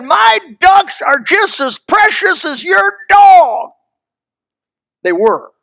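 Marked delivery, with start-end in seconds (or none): 0.0-3.7 slow, much higher and louder shout; retold indignant yell. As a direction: shout it back at the neighbor. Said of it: "I said".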